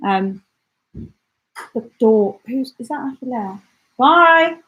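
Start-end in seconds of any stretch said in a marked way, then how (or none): 4.0-4.6 loud, high-pitched call goodbye. Real, not acted. Streamer to someone at the door, calling out. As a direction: call it across to someone leaving.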